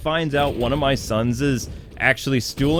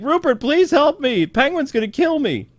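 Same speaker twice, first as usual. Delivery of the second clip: high-pitched imitation of begging; mock panicked plea; 12 semitones higher than usual. Penguin kid calling Rupert for help, mock panic. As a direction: plead in a hammed-up frightened voice. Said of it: "He calls Rupert and he's like".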